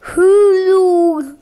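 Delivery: loud, drawn-out hello; called-out greeting. Pretend. Child greeting Number Blocks at play, called out loud. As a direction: sing out a big playful hello.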